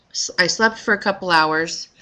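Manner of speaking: ordinary talk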